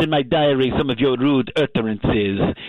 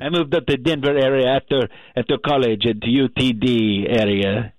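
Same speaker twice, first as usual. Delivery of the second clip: thick mock-French accent; heavily French-accented English. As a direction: play an indignant French wine waiter. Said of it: "you have a French accent".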